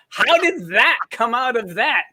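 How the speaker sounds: high, loud, slow incredulous question; mock disbelief. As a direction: draw it out, hammed-up and baffled. Mock shock at woman's accent.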